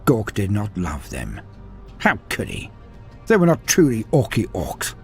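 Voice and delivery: usual speaking voice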